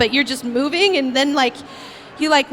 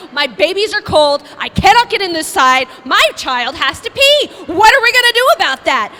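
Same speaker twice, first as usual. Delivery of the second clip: louder, higher mock yelling mothers; mimicked angry moms; 5 semitones higher, 6 dB louder than usual. Moms at library yelling at her, mock shouting. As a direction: yell like frantic moms at the door. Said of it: "they're all screaming at me".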